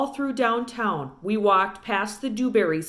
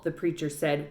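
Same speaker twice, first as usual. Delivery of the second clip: ordinary delivery, nothing marked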